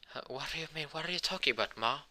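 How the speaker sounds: high-pitched flustered questioning; embarrassed. Acted. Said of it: "Noel be red in face".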